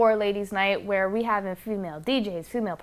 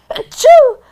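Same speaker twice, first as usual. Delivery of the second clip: big explosive sneeze right into the mic; loud sneeze; 18 dB louder than usual. Real, not acted. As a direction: let out a full loud sneeze. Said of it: "I'm sorry to the ears ... because I know that sucked".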